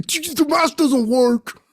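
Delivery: high, loud and slow, drawn out; dopey bystander. Retold or imitated. Clueless people in the background of the movie, slow high drawl. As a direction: drag it out like a clueless extra.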